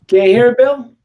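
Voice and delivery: usual speaking voice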